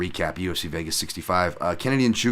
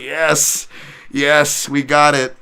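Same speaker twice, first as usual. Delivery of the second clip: higher, louder celebratory yell; cheering; 6 semitones higher, 7 dB louder, much slower than usual. Retold or imitated. Coach cheering his fighter's win, excited yells. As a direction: cheer like your guy just won. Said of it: "I couldn't help but laugh and cheer. Like".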